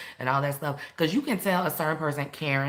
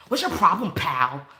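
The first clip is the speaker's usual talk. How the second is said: mock challenge, loud and raised on 'problem'; confrontational; 2 semitones higher, 7 dB louder than usual. Shown as a demonstration. Teacher demonstrating an anti-verbal judo phrase, confrontational. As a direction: square up to a stranger, louder. Example of a forbidden provoking phrase.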